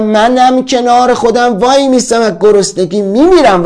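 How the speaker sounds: high, loud vow of defiance; defiant retort. Acted. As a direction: fire back and stand your ground. Defiant answer to the threat.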